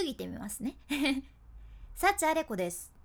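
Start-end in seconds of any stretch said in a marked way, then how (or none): 1.9-2.9 ending dropped hard and heavy; over-lowered ending. Shown as a demonstration. Teacher demos a harsh, over-lowered ending. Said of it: "今極端にやってます".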